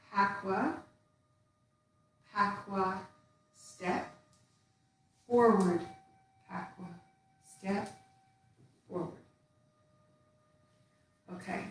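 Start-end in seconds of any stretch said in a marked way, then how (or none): 0.1-9.1 soft, breathy step calls, said while moving; counting the steps. Shown as a demonstration. Teacher counting students through tai chi steps, soft cue calls. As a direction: cue each step quietly as you move.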